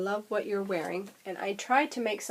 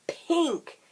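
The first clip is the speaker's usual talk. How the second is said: gushing; 9 semitones higher, 4 dB louder than usual. Real, not acted. Gushing over color.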